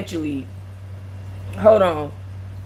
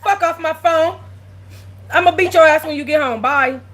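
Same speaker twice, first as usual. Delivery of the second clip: high, louder joking dismissal; mock rude goodbye; 7 semitones higher, 3 dB louder than usual. Real, not acted. To her friend hanging up, mock rude high goodbye. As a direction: shoo her off the phone in fun.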